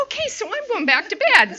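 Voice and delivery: high-pitched, sing-out retold thought; cheery relief. TV off, heading back to bed, brightly relieved. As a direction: toss it off high and pleased.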